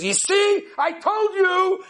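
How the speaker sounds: drawn-out I-told-you-so; triumphant wife. Retold or imitated. Wife tells her husband she was right, slow gloating. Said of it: "and she says".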